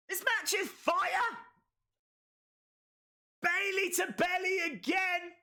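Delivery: high-pitched, fired-up play-by-play; excited commentary shout. Real, not acted. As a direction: shout the moves, thrilled by the match. Calling a thrilling match climax.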